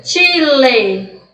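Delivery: CHILE said "tchi-le"; deliberate Chile with T-like "tch" onset; Spanish CH. Shown as a demonstration. Teacher showing how close it sounds to T, "tchile". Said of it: "vai ser muito confundida".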